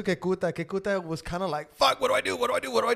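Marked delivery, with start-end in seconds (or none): 1.7-2.9 panicked player. Retold or imitated. Player panicking, voiced by host.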